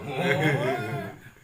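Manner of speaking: loud burst of laughter; laughing. Real, not acted. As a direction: laugh out at the punchline. Laugh at story ending.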